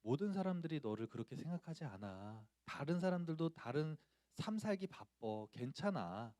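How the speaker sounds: soft, soothing reassurance; imitating a mom's tender comfort; comforting mother. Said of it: "어머니가 저를 위로해 주시면서".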